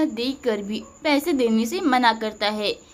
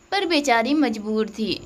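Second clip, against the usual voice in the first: ordinary delivery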